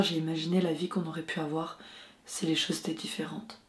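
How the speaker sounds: hushed, intimate confession to a lost love; film monologue. Acted. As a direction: speak very softly, almost whispering, with long pauses. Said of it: "voyons si j'arrive à recréer cette sensation".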